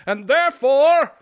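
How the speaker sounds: very loud, slow, high peak of a mock rage; Scrooge's fake outburst, very loud and slow; shouted climax. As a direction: hold the word then explode.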